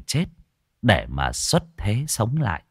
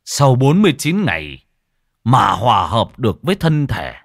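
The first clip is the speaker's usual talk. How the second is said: grave monk; 4 dB louder than usual. Acted. Monk explaining the forty-nine days to the wife, grave. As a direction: count out the days with heavy dread.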